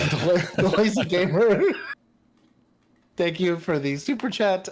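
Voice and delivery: high, loud, laughing thank-you. Real, not acted. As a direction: laugh through the shout-out. Laughs while thanking a chatter.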